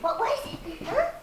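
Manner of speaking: high puppy talk; talking to a dog. Real, not acted. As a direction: coo it up high. Repeated to puppy.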